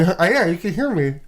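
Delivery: mumbling past a pen; speaking with a pen between teeth; pen in mouth. Real, not acted. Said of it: "I'll put my pen in my mouth".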